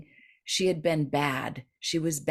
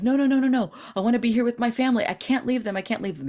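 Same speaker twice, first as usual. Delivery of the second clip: higher, loud quick pleading; protesting; 6 semitones higher, 3 dB louder, much faster than usual. Retold or imitated. Dying old lady refusing to leave, pleading protest. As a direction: beg to stay, a bit rushed. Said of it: "the lady's like".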